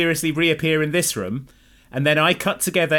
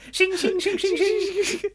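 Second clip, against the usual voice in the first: blade slash; slower than usual. Pretend.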